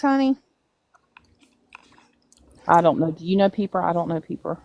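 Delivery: usual speaking voice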